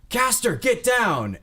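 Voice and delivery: high, loud, drawn-out battlefield yell; warning shout. Acted. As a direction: cry out a desperate warning to a comrade. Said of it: "he's just like".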